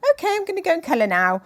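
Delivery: put-on high, fast, breezy child's voice; cheerful little girl. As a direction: chirp it like a carefree little girl off to play. Daughter quoted happily moving on.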